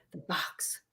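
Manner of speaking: breathless, voiceless whisper of the box; ghostly whisper. Retold or imitated. A ghost echoes Al on the recording, whispered. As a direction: barely breathe it like a spirit voice. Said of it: "and then you hear".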